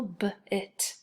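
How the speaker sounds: b-it with the b split from "it"; b-it, segmented; second syllable alone. Shown as a demonstration. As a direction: sound out b-it in two parts. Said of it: "And second syllable".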